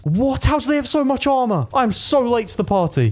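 high-pitched, incredulous outcry; astonished. Real, not acted. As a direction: exclaim up high in disbelief. Astonished at enemy armour.

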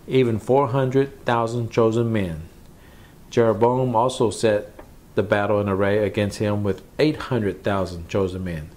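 ordinary talk

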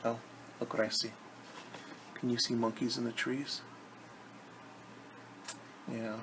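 usual speaking voice

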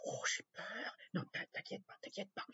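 hushed, barely voiced reassurance; whispered aside. Real, not acted. Aparté chuchoté avec l'enfant. (chuchotement rassurant)

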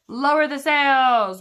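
slow, loud captain's order; captain shouting orders in a storm. A narrator's reading. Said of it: "Braidbeard shouted".

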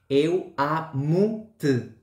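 "Eu amo-te" very slow, European Portuguese accent, "te" almost swallowed. Shown as a demonstration. Teacher contrasting with the Brazilian form, slow European Portuguese accent. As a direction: stretch out "Eu amo-te" like a Lisbon speaker. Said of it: "En el portugués de Portugal sería".

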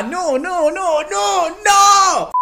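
building exasperated no; rising exasperated no's. Retold or imitated. His own protest to mom.

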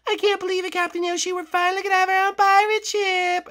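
put-on high sweet girly voice; Birdo voice. To Yoshi in the morning, high sweet Birdo voice. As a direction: pitch way up as a sweet girlfriend.

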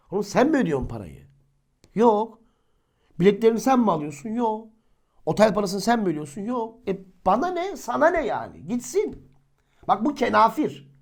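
heated rebuke. Acted. Rebuking gossip, rising to a peak.

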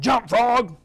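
loud barked order at a frog; a sudden loud shout of command. Retold or imitated. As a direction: call it out like a scientist giving orders.

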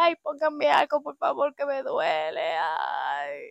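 súplica aguda y quejumbrosa; llorando de dolor. Retold or imitated. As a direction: pide alivio con voz de llanto. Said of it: "yo llorando y le decía". (súplica llorosa a la enfermera)

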